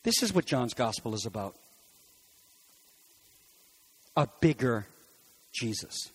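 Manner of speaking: low, soft wrap-up; hushed conclusion. Real, not acted. Preacher closing the point to the congregation, hushed. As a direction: drop to a hush. Quiet close after climax.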